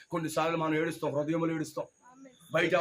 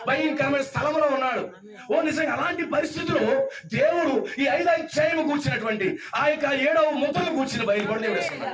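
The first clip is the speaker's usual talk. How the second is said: raised, driving preacher's voice; forceful preaching; 7 semitones higher, 3 dB louder than usual. Real, not acted. Preacher describing John among scorpions and serpents, forceful preaching. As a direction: build the peril phrase by phrase.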